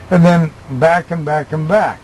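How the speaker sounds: ordinary talk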